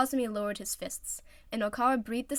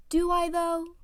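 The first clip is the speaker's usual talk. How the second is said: higher, cocky challenge; challenging; 8 semitones higher, 7 dB louder, slower than usual. Acted. Oikawa challenging Iwazumi, cocky. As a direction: toss it back. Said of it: "Oikawa challenged".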